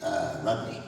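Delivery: low, softened old-hand wrestler impression; mock low-voiced chief; Chief Jay Strongbow. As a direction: drop the voice low, address him quietly. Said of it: "and he said to me".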